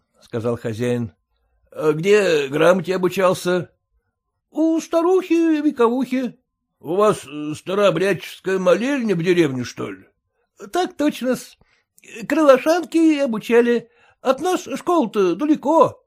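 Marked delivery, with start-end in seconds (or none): 4.1-6.4 higher, deferential servant's voice; bow and scrape to the master; humble clerk. A narrator's reading. Clerk's voice in story. 10.4-16.0 higher, deferential servant's voice; bow and scrape to the master; humble clerk. A narrator's reading. Clerk's voice in story.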